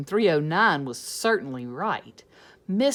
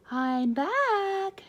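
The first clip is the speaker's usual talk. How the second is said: much higher cheerful greeting; cheerful return; 13 semitones higher, 3 dB louder than usual. Acted. Miss Nelson greeting the class, much higher. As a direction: sing out a bright return.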